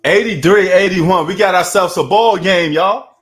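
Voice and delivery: loud, excited score update; excited game call. Real, not acted. Streamer telling viewers it's a ball game, excited shout.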